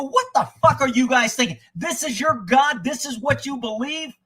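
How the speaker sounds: retold angry tirade at a cowardly father; chewing him out. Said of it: "I fucking chewed his ass out".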